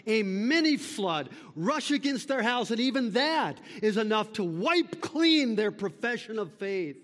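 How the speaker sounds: high, loud fervent sermon; fiery pulpit appeal; impassioned preaching. Acted. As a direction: preach it hard to the congregation. Preaching at full force.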